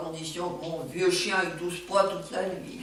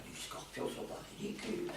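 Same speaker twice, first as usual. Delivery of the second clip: usual speaking voice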